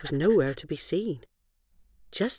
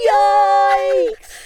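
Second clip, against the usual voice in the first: sudden high loud yikes; startled yelp; 11 dB louder than usual. Acted. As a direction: jump with fright. Girls startled by talking dog.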